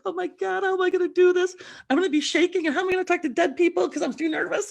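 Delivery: high, loud, rushed panic to a friend; panicked. Retold or imitated. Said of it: "I'm like".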